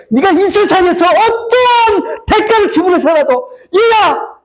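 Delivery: slow, very high anguished plea; God's outcry. Acted. God calls to his child at any cost, anguished outcry. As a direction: stretch the plea into a high cry. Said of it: "그 하나님의 외침이 아니겠어요".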